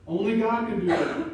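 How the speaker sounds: preaching at full force; emphatic declaration. Real, not acted. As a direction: proclaim it with force. Preaching climax.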